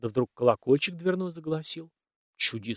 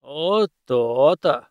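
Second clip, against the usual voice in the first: slow, loud satisfied rumble; a master pleased as he stamps his boots; satisfied bass; 6 dB louder, much slower than usual. A narrator's reading. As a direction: draw it out, pleased with himself. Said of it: "удовлетворённо пробасил".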